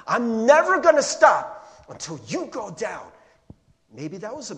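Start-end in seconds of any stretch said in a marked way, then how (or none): 0.1-3.1 high, very loud, drawn-out threat; slow, full-voiced menacing yell; acted threatening shout. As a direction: erupt as a relentless tormentor. Tormentor's threat building to shout.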